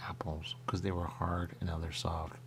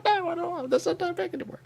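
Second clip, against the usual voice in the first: much higher, louder imitating voice; mock high voice; 5 dB louder than usual. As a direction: squeak it like a fussy customer. Retold voice of a diabetic eater.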